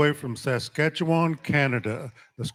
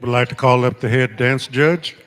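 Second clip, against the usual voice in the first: loud arena call-out; powwow emcee; 2 semitones higher, 6 dB louder than usual. Real, not acted. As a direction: call them up over the crowd. Calling people to the arena.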